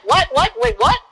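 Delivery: re-enacted high, startled questioning; quick, high-pitched double take; startled confusion. Driver caught off guard by his partner's sighting, startled.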